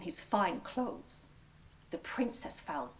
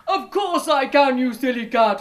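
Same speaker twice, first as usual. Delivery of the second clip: loud, higher scornful ogre voice; ogre's boast; 4 semitones higher, 13 dB louder than usual. A narrator's reading. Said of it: "said the ogre".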